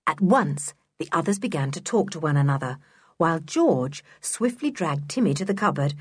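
plain reading voice

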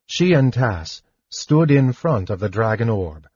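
ordinary talk, nothing marked

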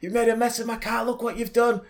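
put-on high Irish old lady scolding, pitched well up. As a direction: nag like an angry little Irish granny. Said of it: "Irish accent".

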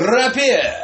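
high, loud joking exclamation; playful shout. Pretend. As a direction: belt it out through laughter. Joke word shouted back amid laughter.

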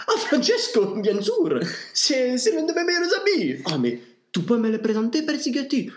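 excited. Acted. Putin gushes about the pope, high and excited. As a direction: gush about your best friend the pope.

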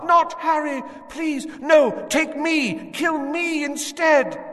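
high, loud desperate pleading scream; screaming woman. Acted. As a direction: beg for the child's life in terror. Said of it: "The woman was screaming".

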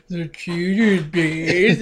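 drawn out, mocking the store name; slow mock announcement. Friends laughing at a store name, slow mock reading. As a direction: stretch each word out mockingly. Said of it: "And Thomas was like".

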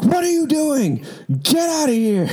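very high, slowed, outraged yell; shocked yell. Retold or imitated. Coworker opening the door on the peeing girl, shocked yell. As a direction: chase her off in disbelief.

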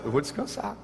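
normal talking voice

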